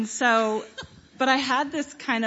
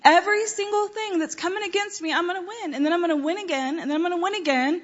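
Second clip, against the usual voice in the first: much higher, fast and pumped up, rising as she goes; hyped up; 8 semitones higher, 3 dB louder, faster than usual. Retold or imitated. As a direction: shout your own victory to yourself. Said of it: "I hyped myself up".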